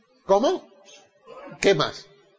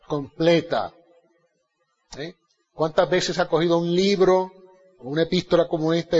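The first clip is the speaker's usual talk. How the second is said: normal talking voice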